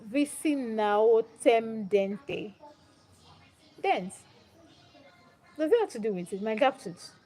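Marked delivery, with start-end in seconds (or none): 0.0-4.1 "Visi now tem dente" drawn out syllable by syllable; puzzled sounding-out. Real, not acted. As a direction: read "Visi now tem dente" very slowly, feeling out each word. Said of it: "I don't understand".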